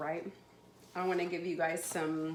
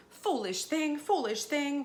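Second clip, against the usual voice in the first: high-pitched, repeated keening reproach; acted dismay, voice lifted high; distressed lament; 7 semitones higher than usual. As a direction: lament helplessly over a dead pet. Woman repeats lament over dead cat.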